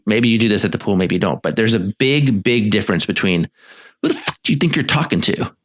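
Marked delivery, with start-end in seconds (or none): 4.0-5.5 sharp, higher, snarky challenge; contemptuous demand. Shown as a demonstration. Therapist demos a partner's contemptuous question. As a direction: spit it out with contempt. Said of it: "complainy, snarky, contemptuous way".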